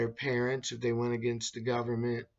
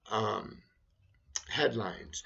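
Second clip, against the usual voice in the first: ordinary delivery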